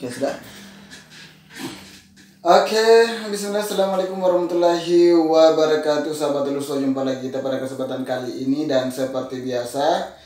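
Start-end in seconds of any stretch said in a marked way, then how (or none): 2.4-6.1 excited greeting. Real, not acted. Vlog opening greeting to viewers.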